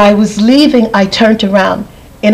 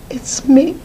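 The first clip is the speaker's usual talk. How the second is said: soft unvoiced answer; whispered reply; 6 semitones higher, 3 dB quieter than usual. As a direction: whisper it gently.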